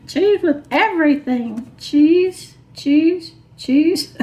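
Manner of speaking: high sing-song chant of cheese; playful sing-song. Acted. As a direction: chant it like a silly jingle.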